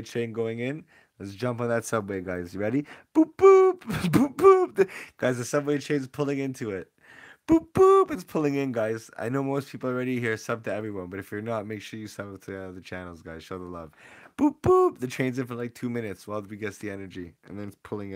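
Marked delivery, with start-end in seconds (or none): 3.1-4.7 high, loud "boop boop" train whistle; train horn imitation. 7.5-8.1 high, loud "boop boop" train whistle; train horn imitation. 14.4-15.0 high, loud "boop boop" train whistle; train horn imitation.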